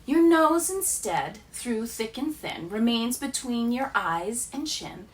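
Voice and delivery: normal talking voice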